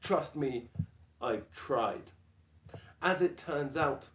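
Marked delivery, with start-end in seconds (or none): none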